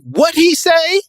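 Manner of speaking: very high, loud incredulous question; hammed-up high-pitched disbelief; mock reaction guy. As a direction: squawk it like a reaction-video guy. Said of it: "just being like".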